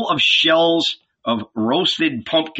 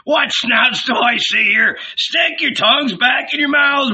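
mocking innkeeper; 10 semitones higher than usual. A narrator's reading. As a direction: bellow at them, laughing at their fear. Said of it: "burst out laughing".

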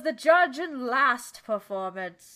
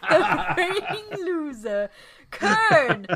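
laughing ring announcer; 5 semitones higher, slower than usual. Pretend.

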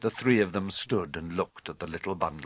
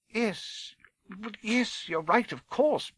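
high, hesitant woman's character voice; reluctantly agreeing professor; 12 semitones higher, slower than usual. Acted. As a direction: give in haltingly, pitched high.

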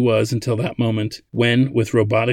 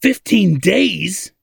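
slow, high-pitched holler; 9 semitones higher, 4 dB louder, much slower than usual. A narrator's reading. Old man reads the deadline, hollering. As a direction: holler it in outraged disbelief, drawing it out. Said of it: "he hollered".

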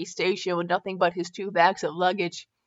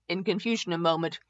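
plain reading voice

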